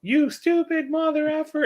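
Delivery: put-on tiny, very high kid voice; imitated little girl echoing an insult; parroting child. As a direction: squeak it like a small child copying a parent. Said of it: "it was a parent in the back seat".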